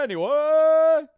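loud drawn-out anyway. Real, not acted. Abrupt topic change after rant.

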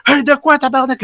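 high-pitched mock yell back; retold yell. The stoned driver yelling at the speaker, a high mock yell. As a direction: play the stoned driver barking back in disbelief. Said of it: "il me gueule après".

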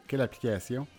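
normal talking voice

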